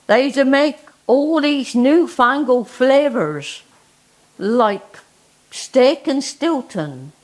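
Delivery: reads the verse in a lively recital voice; performed dialect poem; poem reading. Acted.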